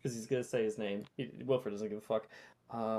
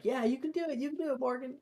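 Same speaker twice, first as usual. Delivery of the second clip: high cheering voice; crowd encouragement; 12 semitones higher, much faster than usual. Acted. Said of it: "People are like".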